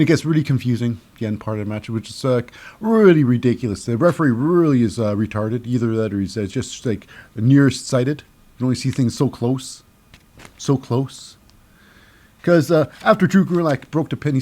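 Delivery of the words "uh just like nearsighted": ordinary talk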